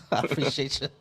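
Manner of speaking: chuckled thanks; amused, laughing sign-off. Real, not acted. Amused goodbye to the troll.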